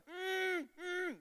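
high, insistent mm mm. Retold or imitated. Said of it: "making a louder noise".

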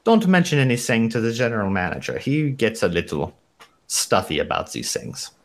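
put-on confiding receptionist with a German accent, dropping away at the end; Ramona. Receptionist warns guest about the general manager, German accent. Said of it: "some variation of my shitty German accent".

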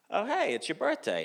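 high-pitched cheery greeting; friendly exclamation. Retold or imitated. Stranger's friendly greeting acted out.

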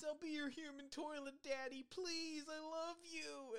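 high, pleading, drawn-out groveling; mock groveling prayer. Parodying the praying reporter to his friends, high groveling whine. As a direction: beg God like an overdone movie zealot. Mocking the movie's desperate prayer.

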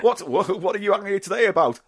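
mock radio host. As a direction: egg on the angry caller.

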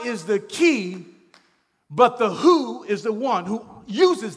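loud, high preacher's cry; preaching at full force. Acted. Pastor preaching to the church, loud and rising. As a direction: drive the point home to the congregation.

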